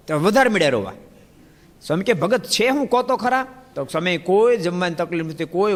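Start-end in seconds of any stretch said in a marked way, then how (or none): 2.1-3.5 coaxing plea. Retold or imitated. Swami coaxing the crying devotee.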